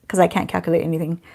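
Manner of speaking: ordinary talk, nothing marked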